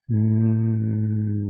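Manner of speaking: "Mm" as a long steady voiced hum; vibrating N. Shown as a demonstration. Teacher showing that N vibrates, long hum. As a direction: hold "Mm" so the nasal buzzes.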